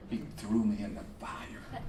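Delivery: breathy dramatic whisper; hushed whisper. Acted. Teapot telling congregation, dramatic whisper. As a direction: drop to a secret whisper.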